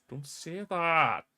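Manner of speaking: high, drawn-out protest; dismayed groaning plea. Real, not acted. Scotland supporter dreads a draw, drawn-out dismayed plea. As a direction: stretch it out slowly in dread.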